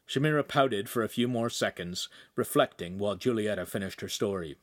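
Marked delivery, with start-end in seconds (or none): none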